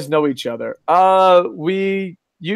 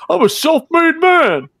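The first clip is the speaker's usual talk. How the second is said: mock boastful tycoon bellow, much higher, louder and slow; mocking Vince McMahon; 13 semitones higher, 5 dB louder, slower than usual. Host mocks the wrestling boss's boast to his co-host, loud mock bellow. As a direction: puff up and mock a bragging boss. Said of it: "he lies about fucking being a self-made man".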